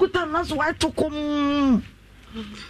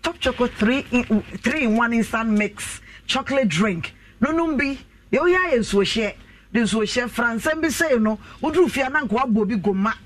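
upbeat radio sponsor read; host reading a sponsor ad on air, upbeat. Acted. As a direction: sell the chocolate drink to listeners. Reads sponsor ad.

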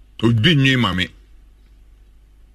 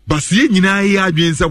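slow emphasis; 8 semitones higher, 4 dB louder, much slower than usual. Real, not acted.

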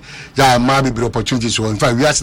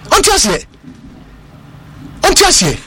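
high, loud incredulous cry; 13 semitones higher, 3 dB louder than usual. Real, not acted. To co-host, disbelieving cry. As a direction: throw up the question in disbelief.

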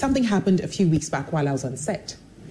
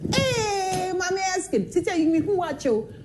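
high, excited street-seller call; market seller greeting; 12 semitones higher than usual. Retold or imitated. Plantain seller hailing her favourite TV star.

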